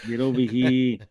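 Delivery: outsider's attempt at an Aussie accent; imitated Australian accent. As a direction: copy the rancher's Australian accent. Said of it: "he was talking like this".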